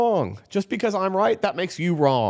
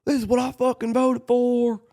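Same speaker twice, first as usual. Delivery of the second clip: mock voter's outburst; 9 semitones higher than usual. Voters who got what they asked for, building mock rage. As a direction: voice angry voters, rising to a bitter shout. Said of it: "the people that said".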